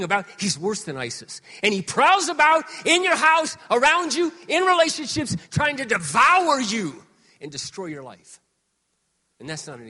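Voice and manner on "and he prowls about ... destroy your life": loud, high warning; intense preaching. Acted. Pastor warning about the devil, loud and high.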